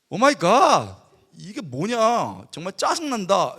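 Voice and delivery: loud, appalled and annoyed; loud retold disgust, slowing down mid-way; loud disgusted outburst. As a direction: shout it like a horror movie is unfolding. Unbeliever reacting to crying crowd.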